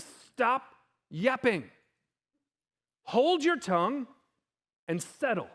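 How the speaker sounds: slow, emphatic order with pauses; aggressive command. Acted. Jesus muzzling the raging storm, slow commanding rebuke. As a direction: lay down each order slowly and firmly. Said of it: "It's so aggressive".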